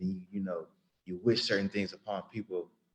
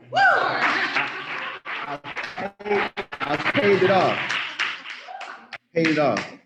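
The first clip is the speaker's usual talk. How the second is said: high, loud burst of joy over a paid-off bill; excited; 9 semitones higher, 5 dB louder, much slower than usual. Real, not acted. To friends, celebrating the paid-off school bill, overjoyed. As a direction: cry it out in delight, then savor the words slowly.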